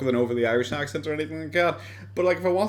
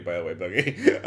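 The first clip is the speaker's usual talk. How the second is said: ordinary talk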